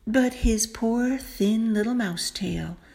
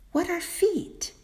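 perplexed; 9 semitones higher than usual. Acted. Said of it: "she asked, perplexed".

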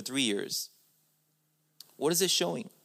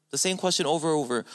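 usual speaking voice

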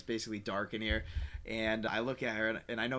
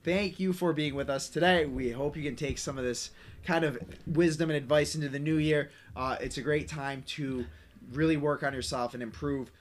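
louder host outro; show sign-off; 4 semitones higher, 5 dB louder than usual. Acted. Hosts thanking listeners at the end, announcer sign-off.

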